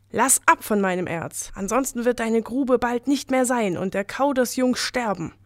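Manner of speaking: ordinary delivery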